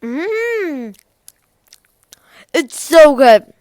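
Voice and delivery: loud raving praise; excited. Real, not acted. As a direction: rave about the taste. Tasting the gummy.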